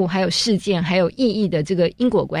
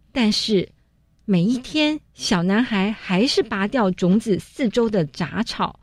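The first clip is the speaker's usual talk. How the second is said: rhythmic read-aloud; 2 semitones higher than usual. Shown as a demonstration. Teacher demonstrating rhythmic picture-book reading to parents. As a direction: read it like music, long notes then quicker ones. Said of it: "我在这边就示范念一次哈".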